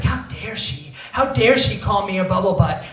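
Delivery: hammed-up indignant rant, building; mock outrage. Ranting to himself about being called a bubble butt, mock outrage. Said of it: "I was like".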